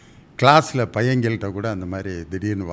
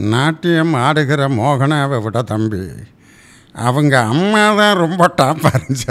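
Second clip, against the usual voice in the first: Balaiah voice; 6 semitones higher, 9 dB louder than usual. Retold or imitated. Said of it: "இந்த பாலையாவோட வாய்ஸோட அப்படியே சொல்லும் பொழுது".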